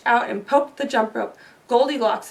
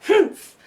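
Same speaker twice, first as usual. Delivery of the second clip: a loud, higher, huffy "Humph!" like an annoyed mother bear; indignant humph; 6 semitones higher, 4 dB louder than usual. A narrator's reading. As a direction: scoff it out with disgust. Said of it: "Mama Bear rolled her eyes".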